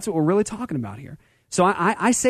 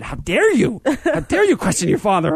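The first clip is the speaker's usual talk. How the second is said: mock offended; 8 semitones higher than usual. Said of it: "At first I was offended".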